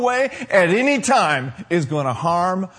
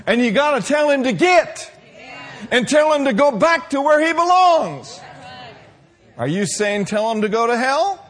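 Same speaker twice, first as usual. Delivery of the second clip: full-force preaching; 6 semitones higher than usual. Acted. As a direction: rouse the congregation to rise up.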